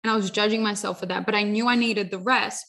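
ordinary delivery

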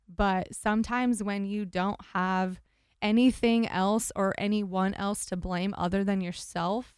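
usual speaking voice

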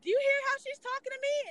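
high, indignant squeal of offense; outraged mock protest. Man protesting to the flight attendant, outraged high squeal. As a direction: play him scandalized, voice shooting way up. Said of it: "He was like".

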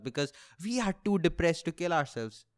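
ordinary delivery

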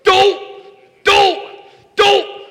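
high, loud cartoon groan of frustration; three loud, high, cartoonish cries; Homer Simpson. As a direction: yelp it like a cartoon dad who just messed up. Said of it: "he goes around the whole time going".

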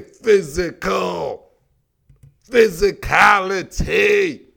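drawn-out, booming emphasis; emphatic hype. Real, not acted. Sports host hyping his team's muscle, emphatic hype. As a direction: stretch each syllable out slow and punchy.

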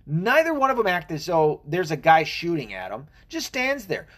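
ordinary talk

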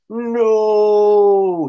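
loud, high anguished cry; helpless cry. A narrator's reading. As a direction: scream it in despair. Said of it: "he cried helplessly".

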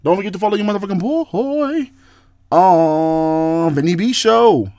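sing-song it and stretch the last words; theatrical plug. Pretend.

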